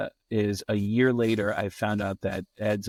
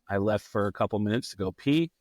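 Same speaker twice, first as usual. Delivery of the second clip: ordinary delivery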